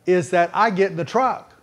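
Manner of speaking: usual speaking voice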